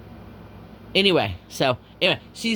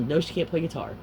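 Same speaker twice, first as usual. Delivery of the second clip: usual speaking voice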